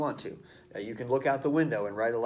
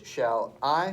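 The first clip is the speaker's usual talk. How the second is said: "Shall I" with the second syllable "I" stretched and rising; iambic stress; 3 dB louder than usual. Shown as a demonstration. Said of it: "the accent is on the second syllable".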